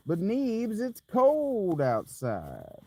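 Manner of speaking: slow, mock-whiny plea; lightly voiced whiny friend; imitating Shaggy. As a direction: drawl it like a pleading friend. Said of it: "Shaggy said".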